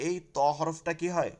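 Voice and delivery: ordinary talk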